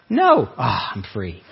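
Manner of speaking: mock relief. Preacher imagining temptation beaten in one go, mock relieved. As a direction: act out easy victory, deflating into relief. Acts out instant victory over temptation.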